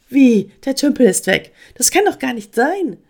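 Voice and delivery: high, disbelieving squirrel question; ganz überrascht. A narrator's reading. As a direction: question it in astonishment. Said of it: "Emma war ganz überrascht". (überrascht und ungläubig nachfragen)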